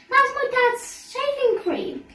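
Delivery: high, slow dismayed cry; horrified at the wasted cream; alarmed child. A narrator's reading. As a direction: panicky complaint. Ellen alarmed.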